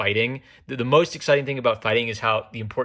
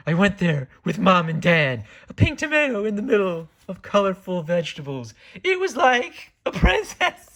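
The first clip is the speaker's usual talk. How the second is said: put-on high, dreamy girly voice; 9 semitones higher, 3 dB louder than usual. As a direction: read it like a wistful girl. Reading Najika's manga line aloud.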